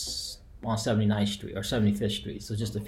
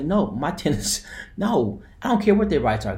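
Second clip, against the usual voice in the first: indignant retort; 5 semitones higher, 4 dB louder than usual. Retold or imitated. Retold argument over tenants' rights.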